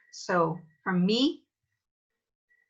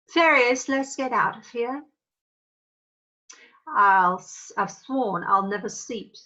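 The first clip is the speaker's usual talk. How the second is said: loud, higher call to the fairies; commanding fairy queen; 4 semitones higher, 3 dB louder than usual. Shown as a demonstration. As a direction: call the fairies away in a huff.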